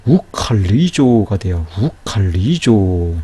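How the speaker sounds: slow 우칼리조 with a strong aspirated k. Shown as a demonstration. Teacher shows the sound change, slow aspirated pronunciation of 우칼리조. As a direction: draw out 우칼리조, puffing the k in 칼. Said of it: "거센 소리 크로".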